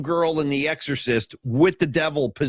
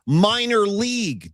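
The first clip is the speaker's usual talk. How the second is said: "minor" wolno i dobitnie, wyżej; przeciągnij "minor league" z naciskiem; stress on MINOR; 3 semitones higher, much slower than usual. Shown as a demonstration. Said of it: "operative words of that sentence".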